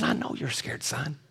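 soft whisper. Retold or imitated. Pastor comforting the scared football player, soft gentle whisper. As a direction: hush him like a frightened child. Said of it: "I said".

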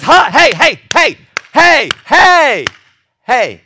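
loud, high repeated calls; shouting for attention. Acted. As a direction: holler to break through their distraction. God calling for distracted people's attention.

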